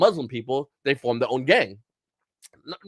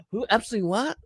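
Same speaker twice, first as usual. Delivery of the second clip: feigned ignorance; 5 semitones higher than usual. Acted. Co-host voices bought-off public forgetting Epstein, mock clueless. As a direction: play clueless, as if the name is new. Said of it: "we're gonna be like".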